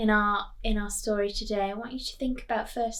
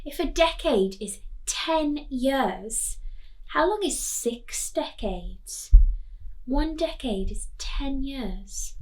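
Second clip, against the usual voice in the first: usual speaking voice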